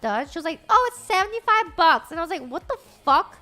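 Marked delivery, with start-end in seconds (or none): 0.7-2.1 mocking the stylist. Stylist raising the quoted price. 2.5-3.4 retold outrage. Reacting to the price hike.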